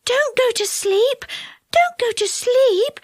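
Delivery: high, urgent fairy cry. Acted. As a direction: plead high and slow to stay awake. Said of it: "cried Silky".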